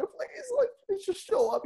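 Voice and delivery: hammed-up falsetto plea; mock begging. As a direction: beg in a tiny falsetto, sending yourself up. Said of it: "begging the Lord".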